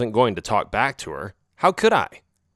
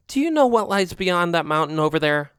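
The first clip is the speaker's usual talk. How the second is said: slightly higher, knowing alley cat; lightly voiced friend cat; 6 semitones higher than usual. A narrator's reading. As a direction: warn him off casually. Narrator voices Oreo.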